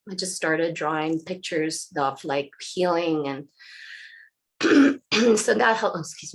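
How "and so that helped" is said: throat catching, voice going rough; hoarse voice. Real, not acted. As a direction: push words through a sore throat. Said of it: "Losing my voice somehow".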